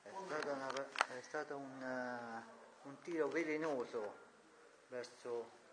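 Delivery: lively football commentator voice; sports commentary. Pretend. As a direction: call the shot like a TV commentator. Mock match commentary.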